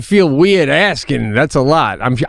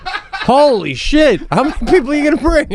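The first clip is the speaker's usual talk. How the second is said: words squeezed out through laughter, pitched up; laughing disbelief; 9 semitones higher than usual. Real, not acted. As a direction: laugh while asking, can't believe it.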